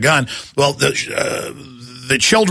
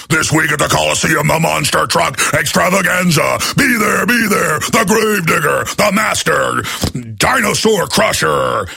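monster truck ad; 2 semitones higher than usual. Retold or imitated. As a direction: sell the arena show at full blast. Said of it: "Sounds like it's a monster truck advertiser".

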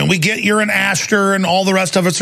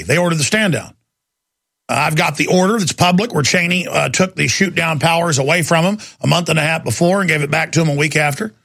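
ordinary delivery

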